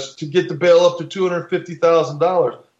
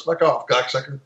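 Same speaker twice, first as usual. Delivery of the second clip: retold insult. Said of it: "He goes".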